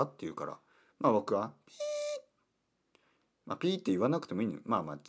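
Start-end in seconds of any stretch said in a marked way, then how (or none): 1.7-1.8 cute bleep word. Shown as a demonstration. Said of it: "かわいい言い方でね".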